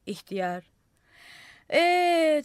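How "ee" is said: loud, high self-satisfied "Ee"; boastful drawn "Ee". A narrator's reading. Old man answering the curious crowd, proud and loud. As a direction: savour the moment.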